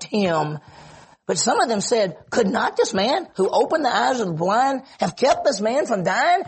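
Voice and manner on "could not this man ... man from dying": high, insistent questioning; challenging doubt. Acted. Preacher voices doubters at Lazarus's tomb, high challenging doubt. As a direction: press each question as an acted complaint.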